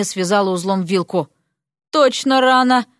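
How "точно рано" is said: ordinary delivery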